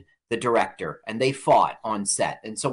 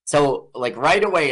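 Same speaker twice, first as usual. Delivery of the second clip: normal talking voice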